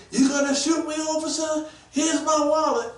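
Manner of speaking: high, loud challenge to an officer; mock alarmed protest. Marchers crying out to a police officer, mock alarmed protest.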